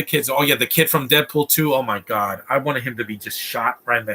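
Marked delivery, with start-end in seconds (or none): none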